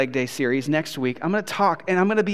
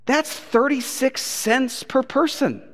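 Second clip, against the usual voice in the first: slowly spelled out, higher pitch; slow disbelief; 8 semitones higher, slower than usual. Retold or imitated. Preacher doing the math for the crowd, slow disbelief. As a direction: draw out each word of the sum.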